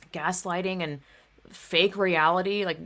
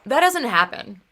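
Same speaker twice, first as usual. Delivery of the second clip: louder, higher quote of her flat denial; dismissive denial; 5 semitones higher, 7 dB louder than usual. Retold or imitated. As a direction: lightly mimic her brushing it off. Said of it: "and she goes".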